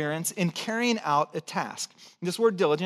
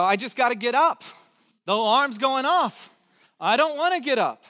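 higher, whiny sleepy complaint; mock groggy whine; 6 semitones higher, 3 dB louder than usual. Pastor voicing a congregant dreading the alarm, mock whine. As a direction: act out not wanting to get up.

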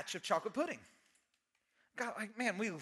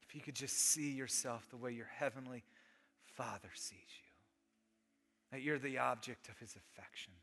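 tender hush; 10 semitones lower, 11 dB quieter than usual. Real, not acted. Closing tender appeal.

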